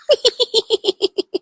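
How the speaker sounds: high, slow, laughing through words; cracking up at the fart. Real, not acted. Brother farts on mic.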